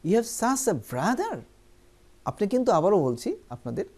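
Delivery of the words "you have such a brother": higher, slow amazed exclamation. Shown as a demonstration. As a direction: sound amazed at the brother. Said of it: "তাহলে আমরা বলবো". (বিস্ময়ের সুরে)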